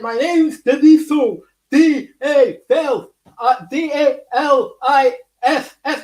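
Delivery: slow, deliberate spelling of the name through a tongue injury; slurred slow spelling of Daliso. Retold or imitated. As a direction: spell your name out slowly, letter by letter. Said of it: "when I said".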